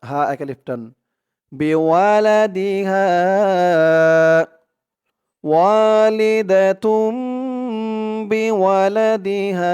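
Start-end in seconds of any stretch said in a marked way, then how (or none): 1.5-9.7 higher, slow chanted words; recitation practice. Shown as a demonstration. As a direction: intone each word in recitation style. (উঁচু সুরে ধীর তিলাওয়াত)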